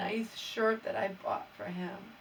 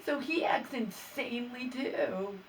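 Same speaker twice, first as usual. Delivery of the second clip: ordinary talk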